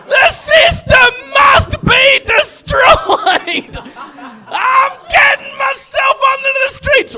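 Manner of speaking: hammed-up outraged shouting, slow and high; over-the-top ranting yell; comic shouting rant. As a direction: bellow it like a mock revolutionary.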